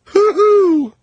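high loud derisive hoot; mocking whoop. Real, not acted. Host jeering at Sean Penn's Baghdad assignment with a high loud whoop. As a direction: whoop it up like it's a joke.

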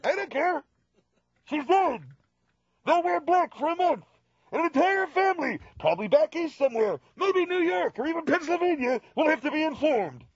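high, solemn pronouncement; mock grave dad. Dad solemnly announcing the death to his son, high voice.

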